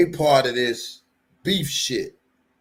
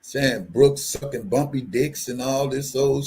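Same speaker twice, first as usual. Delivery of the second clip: ordinary talk, nothing marked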